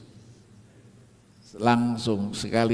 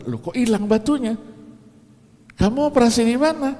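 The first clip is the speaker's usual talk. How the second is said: high, fast, louder amazed question; doctor exclaims, much higher and quicker; astonished doctor; 11 semitones higher, 4 dB louder than usual. Retold or imitated. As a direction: play the baffled doctor who can't believe it. Doctor finds stone gone.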